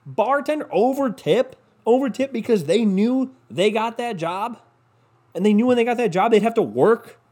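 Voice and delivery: high, mock-shocked questions; sarcastic disbelief. Podcaster ranting about overtipping bartenders, sarcastic disbelief.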